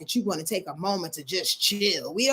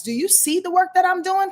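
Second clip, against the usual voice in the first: higher, pleading for credit; a touch of wanting to be noticed; needy demand for credit; 8 semitones higher, 4 dB louder than usual. Retold or imitated. Moon wanting its hard work seen, mock needy. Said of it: "it feels like there's some weird ass ... kind of feel".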